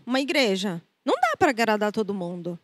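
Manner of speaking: ordinary delivery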